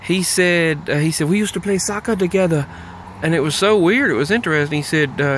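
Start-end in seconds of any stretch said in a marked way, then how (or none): 1.3-2.7 "We used to play soccer together" in a light imitated Somali accent; Somali accent impression. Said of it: "He talked like this right there".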